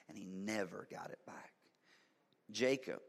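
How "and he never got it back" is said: hushed, breathy finish; whisper. Acted. Preacher telling how Esau lost his birthright, hushed whisper. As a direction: drop to a whisper for the loss. Sermon climax on Esau's loss.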